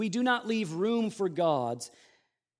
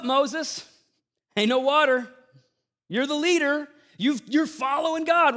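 higher, louder whiny complaining crowd; grumbling Israelites; 5 semitones higher, 4 dB louder than usual. Retold or imitated. Thirsty Israelites griping at Moses in the desert, higher and louder mock whine. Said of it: "The people grumbled against Moses, saying".

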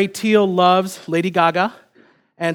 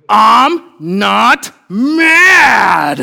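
very loud, drawn-out strained yell; hammed-up furious denial, each word stretched out slowly; mad toddler; 3 semitones higher, 9 dB louder, much slower than usual. Mad little son answering his dad, exaggerated angry yell. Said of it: "kid was mad".

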